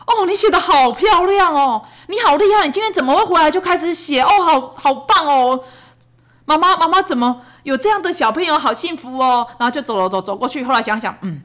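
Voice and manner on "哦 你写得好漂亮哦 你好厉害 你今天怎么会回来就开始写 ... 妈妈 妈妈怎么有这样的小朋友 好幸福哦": put-on shower the kid with over-the-top compliments; gushing praise. Mother gushing over her son's neat writing, high and sing-song.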